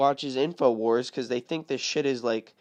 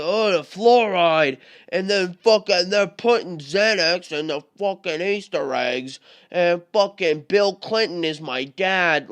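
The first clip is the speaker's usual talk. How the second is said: loud, higher mock rant of Alex Jones; 5 semitones higher, 9 dB louder than usual. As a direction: ham up a frantic conspiracy rant, louder and higher. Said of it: "he's all like".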